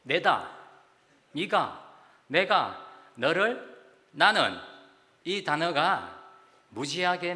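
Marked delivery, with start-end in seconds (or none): 0.0-4.6 each word 내다, 네가, 내가, 너를, 나는 punched loud and set apart by pauses; stressed key words. Shown as a demonstration. Pastor listing the repeated words to the congregation, each punched loud. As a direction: hammer each of 내다, 네가, 내가, 너를, 나는 loudly. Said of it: "이 단어라는 거예요".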